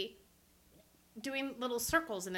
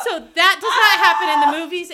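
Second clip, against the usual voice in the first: loud, high, laughing declaration; loud laughing insistence to friends; emphatic exclaim; 7 semitones higher, 17 dB louder than usual. Retold or imitated. Quoting own thought, laughing.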